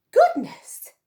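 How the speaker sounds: shrieked high and loud in amazement; a huge hammed-up cry of surprise; exclaimed. Missus Honey reacts to her new hat, shrill loud exclaim. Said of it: "she exclaimed".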